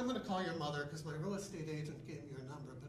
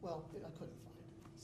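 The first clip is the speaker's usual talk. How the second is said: usual speaking voice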